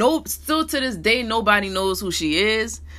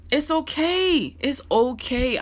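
slow, gentle it's okay; slow, tender comfort for a hurting viewer; reassuring; 4 semitones higher, slower than usual. Real, not acted. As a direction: soothe the viewer, take your time.

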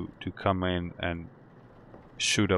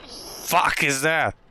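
startled; 8 semitones higher, 4 dB louder, much slower than usual. Real, not acted. Startled by something in game.

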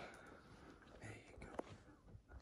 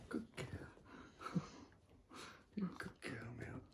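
much softer hushed whisper with a little laugh; soft praise whisper; 2 semitones lower, 13 dB quieter than usual. Real, not acted. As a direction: breathe praise gently. Calming a baby with praise.

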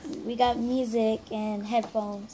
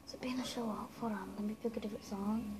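voiceless whisper; leaning in to whisper; 3 semitones lower, 15 dB quieter, faster than usual. Real, not acted. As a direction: whisper it under the music. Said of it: "we have to whisper".

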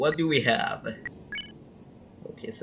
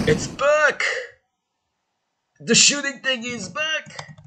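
excited squeaky voice; 14 semitones higher, slower than usual. Acted. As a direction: cheer it out in a squeaky voice.

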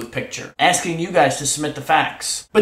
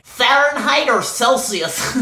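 high, drawn-out mocking query; exaggerated slow question, pitched up; mock questioner; 8 semitones higher, much slower than usual. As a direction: ask it slowly in a higher, put-on pitch. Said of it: "And then when he says".

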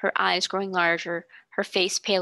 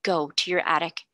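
stern command; 2 semitones lower than usual. A narrator's reading. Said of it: "she commanded".

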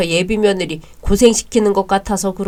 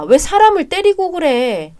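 shocked protest; 9 semitones higher, 5 dB louder than usual. Acted. Fiancée protests the hitting.

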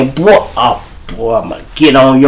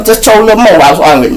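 ordinary talk

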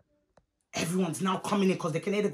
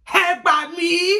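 high, loud, slow exclamation; outraged auntie; 7 semitones higher, 5 dB louder, slower than usual. Retold or imitated. Auntie shocked at being answered back, loud drawn-out outrage. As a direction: stretch it out in mock outrage.

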